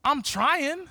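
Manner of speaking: frustrated believer. Retold or imitated. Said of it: "You ever feel like".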